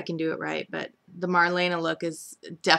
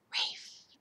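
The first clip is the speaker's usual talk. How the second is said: hushed, voiceless whisper; gossipy whisper for suspense; whispered reveal; 13 dB quieter than usual. Real, not acted. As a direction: whisper the name like a scandal. Reveals who overheard the secret.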